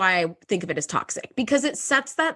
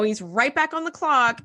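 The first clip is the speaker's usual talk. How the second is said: ordinary talk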